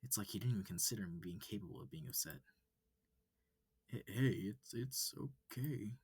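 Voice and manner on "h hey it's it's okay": slow, faltering reassurance; calm her down, fumbling; stammered soothing. Acted. Amicus trying to soothe the upset human, stammering.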